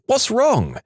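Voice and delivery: high-pitched shocked cry; shocked shout. A narrator's reading. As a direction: cry out in alarm, pitched right up. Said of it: "It shocked me and I shouted".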